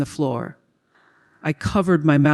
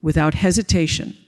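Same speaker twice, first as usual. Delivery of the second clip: usual speaking voice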